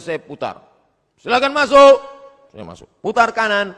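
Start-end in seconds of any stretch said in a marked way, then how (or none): 1.2-2.0 high, loud mock official calling him in; examiner's call. Meniru penguji tes kesehatan. 3.0-3.7 high, loud mock order to turn; examiner's command. Meniru perintah penguji.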